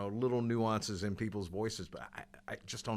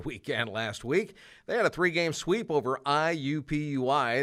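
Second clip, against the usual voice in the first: lively, higher radio rundown, a touch louder; sports announcer; 4 semitones higher, 6 dB louder than usual. Acted. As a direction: run through the weekend scores like a campus DJ. Host reads sports rundown.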